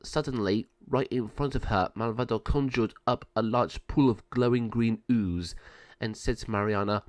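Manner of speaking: ordinary delivery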